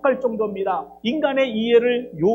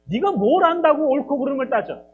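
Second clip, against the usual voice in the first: louder stern rebuke, higher; stern scolding; 4 semitones higher, 6 dB louder than usual. Acted. God scolds Jeremiah to obey, louder stern rebuke. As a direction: tell him off like an angry father. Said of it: "아, 야단치고 있어".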